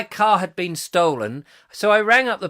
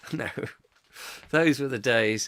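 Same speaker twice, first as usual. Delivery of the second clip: ordinary delivery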